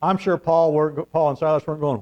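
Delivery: ordinary talk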